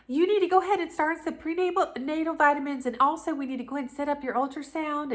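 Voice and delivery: high and fast, rattling off instructions; rattling nurse. Retold or imitated. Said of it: "she just kept talking".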